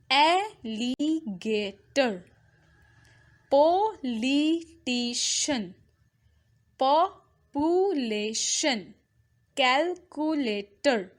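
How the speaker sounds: slow, each word in four separate beats; drawn-out syllable-by-syllable teaching; in small syllable parts. Shown as a demonstration. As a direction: break every word into its syllables, pausing between. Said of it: "इन छोटे छोटे parts में".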